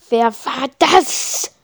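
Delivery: a priest's voice thundering over the crowd; thundering demand. A narrator's reading. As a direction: boom it through the church, slowly. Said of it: "donnert Maglos Stimme".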